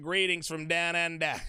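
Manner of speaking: attempted Australian accent on flattened vowels; mock Australian twang. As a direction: do your best amateur Aussie accent. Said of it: "That's my best Australian accent".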